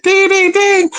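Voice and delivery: ding it out like the phone line's error tones. Retold or imitated. Mimicking the disconnected-number recording, high chime voice.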